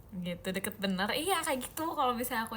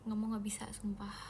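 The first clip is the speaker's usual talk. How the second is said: whispered refusal; 2 semitones lower, 7 dB quieter than usual. Real, not acted. Refusing mosquitoes, drops to whisper.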